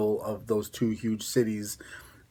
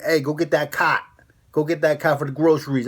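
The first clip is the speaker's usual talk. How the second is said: louder, higher East Coast holler with dropped Rs; mock East Coast accent; 4 semitones higher, 5 dB louder than usual. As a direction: bark it like an East Coast local, accent thick. Acts out someone ordering cart fetched.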